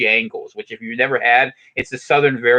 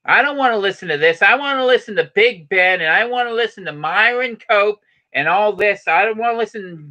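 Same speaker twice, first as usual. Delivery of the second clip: put-on a light Pittsburgh yinzer accent, higher; 7 semitones higher than usual. A yinzer husband complaining about YouTube, a light Pittsburgh accent. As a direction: grumble like a Pittsburgh fan wanting the Steelers. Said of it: "I'll talk like a yinzer".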